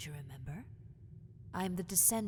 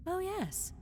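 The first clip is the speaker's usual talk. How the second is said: delighted; 12 semitones higher than usual. Acted. Delighted reveal.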